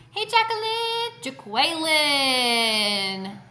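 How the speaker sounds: loud drawn-out call across the house; sing-song holler to another room. Real, not acted. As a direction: call her name long and loud from afar.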